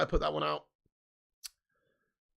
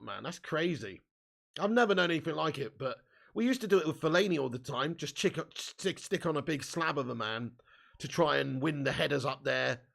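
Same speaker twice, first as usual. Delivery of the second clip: usual speaking voice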